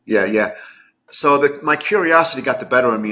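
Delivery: normal talking voice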